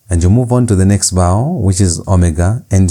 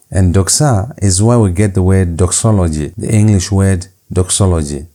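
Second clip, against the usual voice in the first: ordinary delivery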